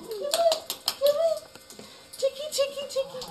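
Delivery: high, sing-song coaxing to a pet; coaxing pet talk. Real, not acted. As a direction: call the little animal over sweetly.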